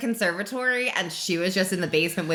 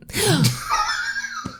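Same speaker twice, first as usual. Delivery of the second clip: gasp. Real, not acted. Realizes she got the title wrong.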